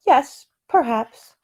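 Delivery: slow, drowsy agreement; sleepy. Acted. Sleepy woman agrees before dozing off, slow and drowsy. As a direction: drift off as you answer.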